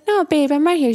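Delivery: high, insistent retold reassurance; reassuring father. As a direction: imitate a dad urgently comforting his child. Father reassuring his crying daughter.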